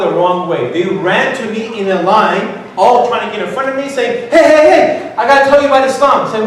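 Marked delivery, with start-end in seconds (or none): 4.3-6.3 higher and louder, racing to be first; pushy brothers crowding the newcomer; eager shouting. Retold or imitated. Brothers rushing at him.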